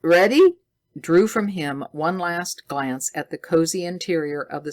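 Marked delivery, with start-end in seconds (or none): 0.0-0.6 a sharp, impatient call, higher and louder. A narrator's reading. Scientist hurrying his companion out, impatient. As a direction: hurry him along. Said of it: "Doctor Munson's impatient".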